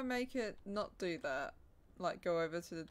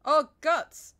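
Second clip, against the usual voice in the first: high, loud startled yelp; startled exclamation; 9 semitones higher, 18 dB louder than usual. Real, not acted. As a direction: jump at the sight. Reacting to gore in game.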